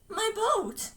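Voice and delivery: high, plaintive lost voice; lost, wavering child ghost. Acted. Dead George calling to his brother, high and wavering. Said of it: "George's lost voice rose, wavering in the tunnel".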